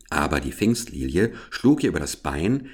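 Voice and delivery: ordinary delivery, nothing marked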